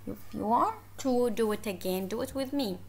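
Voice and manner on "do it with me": normal talking voice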